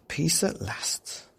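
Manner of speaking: slow sighing relief; relieved sigh. Acted. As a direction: sink into bed relieved. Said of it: "he said to himself".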